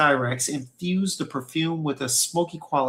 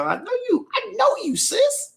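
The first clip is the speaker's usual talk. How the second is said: put-on squealed falsetto teasing a girlfriend; playful high voice. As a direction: go way up high and sassy, like a bestie.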